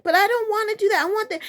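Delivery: put-on high, rushed child's protest; whining child. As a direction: imitate a kid mid-tantrum. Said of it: "and they're just like".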